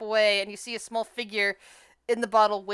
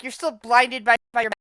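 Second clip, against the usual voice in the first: normal talking voice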